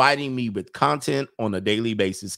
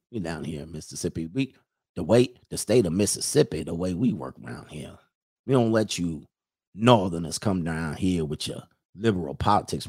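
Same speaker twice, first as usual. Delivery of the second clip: put-on Mississippi accent; 6 semitones lower than usual. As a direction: mumble it low like a Mississippi local warning outsiders. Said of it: "do the Mississippi accent".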